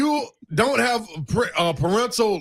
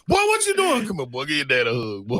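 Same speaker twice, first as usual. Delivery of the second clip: stern dad voice; 7 semitones lower than usual. Retold or imitated. Dad voicing himself to son.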